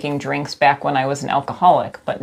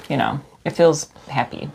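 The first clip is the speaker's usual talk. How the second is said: normal talking voice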